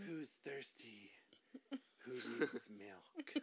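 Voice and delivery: slow creepy whisper; silly clown. Pretend. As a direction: hush it slow like a leering creep. Creepy joke, cohost says shut up.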